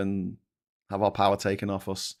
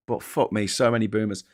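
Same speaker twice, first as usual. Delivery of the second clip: normal talking voice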